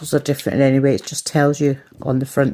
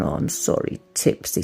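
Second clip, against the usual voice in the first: soft, low whisper to the cat; gentle whispered sorry to her cat; whispered apology; 9 semitones lower, 6 dB quieter than usual. Retold or imitated. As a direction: hush it out as if soothing a pet.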